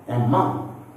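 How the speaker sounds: "among" rising onto a strong MONG; stress on second syllable. Shown as a demonstration. Teacher listing prepositions, second-syllable stress. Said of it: "stressing preposition on the second syllable".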